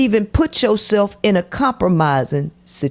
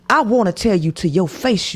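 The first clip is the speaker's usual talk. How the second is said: ordinary talk, nothing marked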